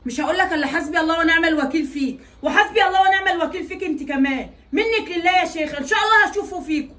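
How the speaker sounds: loud, bitter curse on them both; mother loudly cursing daughter and son-in-law. Acted. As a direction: call God's judgment down on them, loudly. Mother cursing them as she leaves.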